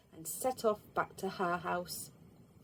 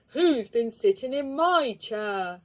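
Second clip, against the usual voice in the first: big bear voice; 2 semitones higher, 7 dB louder than usual. Acted. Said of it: "said the big bear".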